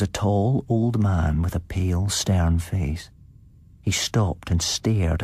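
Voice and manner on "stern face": plain reading voice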